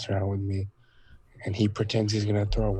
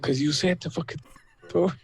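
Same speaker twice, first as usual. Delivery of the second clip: put-on higher, indignant child voice; kid's retort; 8 semitones higher than usual. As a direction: protest like a wronged little kid. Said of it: "And I was like".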